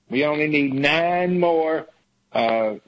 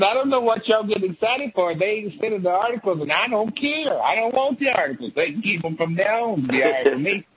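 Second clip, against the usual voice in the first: higher, prissy mock senator voice; Lindsey Graham impression; 4 semitones higher than usual. Mocking Lindsey Graham not caring about the articles. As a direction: toss it off like a bored senator. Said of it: "Lindsey Graham gets over and says".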